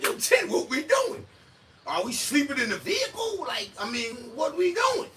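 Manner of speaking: very high, loud squawk; mock incredulous questioning. Asking Tim what we doing, mock incredulous squawk. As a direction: squeal it in mock disbelief.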